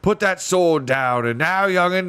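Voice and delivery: high, loud warning cries; dwarfs crying out. A narrator's reading. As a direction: shout at someone to drop the sword. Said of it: "Jill heard cries of".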